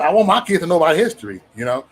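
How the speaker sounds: normal talking voice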